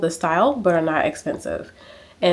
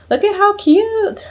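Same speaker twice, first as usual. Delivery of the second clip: much higher, squealing and slow; excited squeal; 5 dB louder, slower than usual. Real, not acted. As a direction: gush over something adorable. Gushing over a Pinterest photo.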